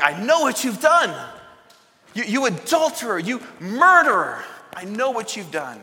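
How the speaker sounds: high, loud accusing shout; in his face. Pretend. Imagined Nathan storming the throne room, furious and loud. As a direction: jab a finger and yell the charge. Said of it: "kick the doors open, get in his face".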